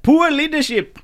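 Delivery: poor leadership, pitched high; emphasis on poor leadership. Shown as a demonstration. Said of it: "I emphasize that".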